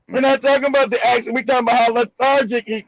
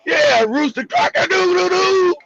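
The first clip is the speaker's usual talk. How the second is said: rooster crow imitation; 7 semitones higher than usual. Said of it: "is he a rooster crowing or something".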